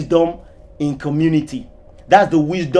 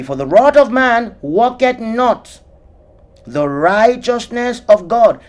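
slow, louder, higher solemn verse; scripture reading; 5 semitones higher, 4 dB louder, slower than usual. Acted. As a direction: proclaim the verse slowly.

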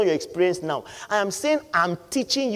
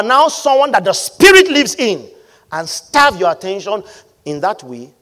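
loud, forceful sermon delivery; preaching at full force; 5 semitones higher, 12 dB louder than usual. Acted. Preacher exhorting the church about attention, loud and forceful. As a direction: drive the point home to the congregation.

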